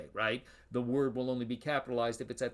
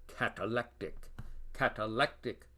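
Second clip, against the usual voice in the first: usual speaking voice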